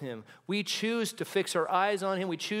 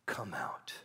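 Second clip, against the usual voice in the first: soft unvoiced call; gentle whispered summons; 10 semitones lower, 7 dB quieter than usual. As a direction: whisper the invitation. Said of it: "Jesus is saying".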